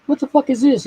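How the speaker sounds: high, mock-startled outburst; imitating a startled man; startled guy. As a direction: blurt it like a shocked passerby. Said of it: "and he goes".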